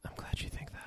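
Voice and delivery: whispered, with no voice. Real, not acted. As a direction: breathe it out dryly. Dry reply to "good to be back.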